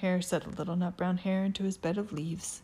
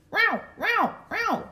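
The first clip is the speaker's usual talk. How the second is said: high dog bark; 5 dB louder, slower than usual. Acted.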